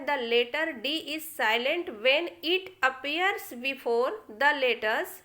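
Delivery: very slow, careful reading; slow rule reading. Shown as a demonstration. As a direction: dictate the rule slowly. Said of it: "rule one कहता है". (धीरे धीरे नियम पढ़ना)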